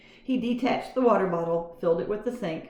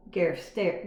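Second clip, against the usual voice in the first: ordinary delivery, nothing marked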